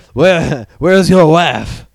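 loud, high mock French accent; drawn-out French demand, hammed up; French pirate. Pirate demanding a man's wife, hammed-up French accent. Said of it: "weird French pirate crew".

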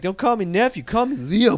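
put-on high-pitched kid demanding respect; mock a little tough guy correcting his uncle; imitated gangster nephew. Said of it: "like, gangster sometimes, my nephew".

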